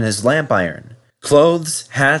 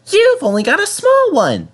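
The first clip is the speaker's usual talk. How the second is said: much higher, cheeky little engine voice; cheeky Percy; 4 dB louder than usual. Acted. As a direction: tease the bigger engine. Percy teasing Henry.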